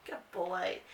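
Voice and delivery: soft praise. Real, not acted. Owner quietly praising her dog, soft voice.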